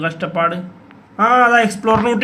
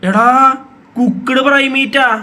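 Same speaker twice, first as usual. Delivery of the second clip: excited; 5 semitones higher, 4 dB louder, slower than usual. Real, not acted. Finally gets the item.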